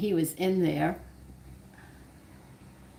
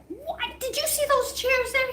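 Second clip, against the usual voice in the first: high, shrieking mock scream; retold scream. Mother shrieking at her son over the wet floor, in a high mock scream. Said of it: "I screamed".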